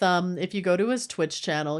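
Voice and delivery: usual speaking voice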